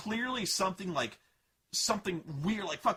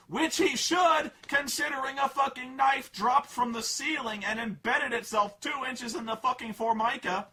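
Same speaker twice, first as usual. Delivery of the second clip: indignant rant; 7 semitones higher, 5 dB louder than usual. Real, not acted. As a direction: hammer each word in outrage.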